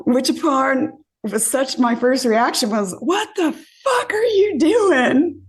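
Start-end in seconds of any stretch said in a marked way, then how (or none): 3.0-5.4 high, slow, laughing disbelief yell; outraged shout. Retold or imitated. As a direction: shriek it in stunned outrage, half laughing. Retelling being slapped, outraged.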